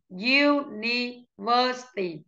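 slow, syllable-by-syllable "University"; slow model pronunciation. Shown as a demonstration. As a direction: spell out "University" by syllables, slowly. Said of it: "đánh trọng âm vào từ". (đọc chậm "University" từng âm tiết)

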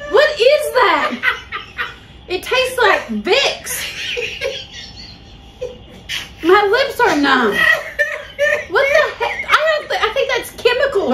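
high, laughing squeals of disgust. Real, not acted. Tasting mouthwash, laughing and squealing.

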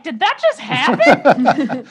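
loud, slow, laughing astonishment; mock stunned, half laughing; stunned disbelief. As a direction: act out dumbfounded disbelief, drawing it out. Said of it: "she's just staring like".

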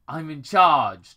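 loud, slow "I'm in charge"; loud interruption. Acted. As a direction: override him, slowly. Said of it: "Doctor Robotnik interrupted".

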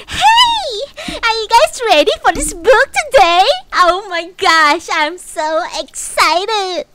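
very high, loud, bubbly greeting; cutesy VTuber voice. Pretend. Vtuber imagines greeting chat in the cutesy voice, squealing. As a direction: gush like a sugary morning host. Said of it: "I'm like".

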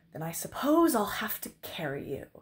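grudging, unenthusiastic offer; reluctant. A narrator's reading. Knight offering to carry the princess, reluctant. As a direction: offer it while plainly disliking the idea. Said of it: "didn't sound as though he liked".

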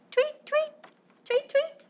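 high chirpy tweet tweet; bird tweets. Shown as a demonstration. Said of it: "What does a bird say?".

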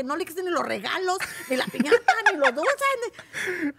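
loud, high rising list. Retold or imitated. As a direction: insist urgently. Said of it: "le dije". (súplica urgente y fuerte)

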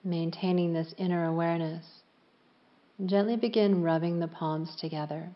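calm yoga nidra voice with long pauses; unhurried, evenly pitched relaxation guidance; guided meditation. Real, not acted. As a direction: guide them gently into stillness, leaving space after each phrase.

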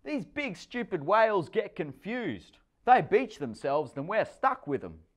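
high, loud bossy explaining; know-it-all girl. A narrator's reading. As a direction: lecture the little brother.

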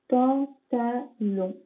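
"Pantalon" stretched out slowly, syllable by syllable; slow syllable dictation. Shown as a demonstration. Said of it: "syllable dictation".

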